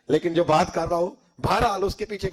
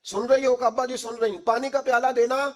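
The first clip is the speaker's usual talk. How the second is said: raised, higher call to a daughter in the next room; 7 semitones higher than usual. Acted. Father calling his daughter for a bowl of water, raised voice. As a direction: call out to her across the house. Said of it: "پھر آواز دی".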